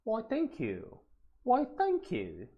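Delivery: high, playful put-on English thank-you; lilting, exaggerated English accent; hammed-up English politeness, pitched high; mock English accent. Mock accent, English roots joke.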